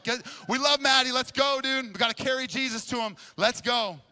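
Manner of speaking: rally the crew like you're charging out the door; amped, shouting battle cry; fired-up hype shout. Retold or imitated. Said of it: "We were fired up".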